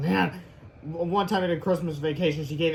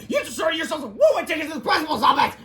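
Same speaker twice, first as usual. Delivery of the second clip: high, loud scolding; angry teacher shout; 11 semitones higher, 5 dB louder than usual. Acted. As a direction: scold the boys furiously.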